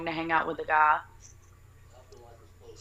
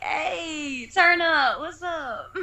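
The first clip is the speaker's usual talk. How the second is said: high-pitched whooping party greeting; party hype; 9 semitones higher, 4 dB louder, much slower than usual. Retold or imitated. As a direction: hype it up like the drinks arrived. Retelling her eager party reply.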